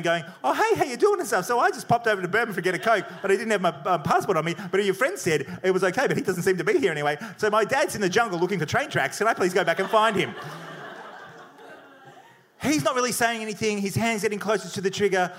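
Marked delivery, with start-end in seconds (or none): 0.4-10.3 frantic babbling. Retold or imitated. Nervous traveler talking his way past an armed guard, frantic babbling. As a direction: babble it out like a madman. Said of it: "babbling like a madman".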